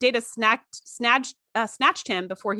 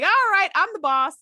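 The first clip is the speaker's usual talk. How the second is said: cocky Q; 7 semitones higher than usual. Retold or imitated. Q barging in on Geordi, mock cocky. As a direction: puff up like a pompous boss. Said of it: "Q is coming in like".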